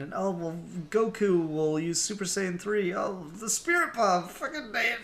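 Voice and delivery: mock brainstorming, pitch climbing toward the end; improvising writer. Writer throwing plot fixes at the wall, mock improvising. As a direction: lightly act out mounting desperate ideas.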